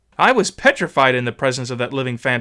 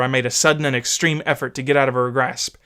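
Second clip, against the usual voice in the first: plain reading voice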